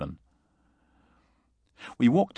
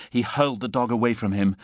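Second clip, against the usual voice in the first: plain reading voice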